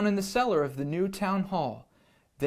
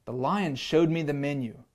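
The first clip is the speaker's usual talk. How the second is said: usual speaking voice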